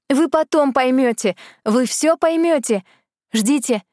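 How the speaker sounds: high, excited fairy calling out; pixie. Acted. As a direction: call back while flying away. Fairy calling while flying off.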